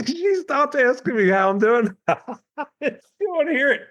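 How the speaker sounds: amused laugh running through the line. Real, not acted. Laughing while telling.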